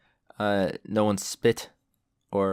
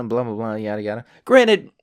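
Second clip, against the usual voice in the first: ordinary delivery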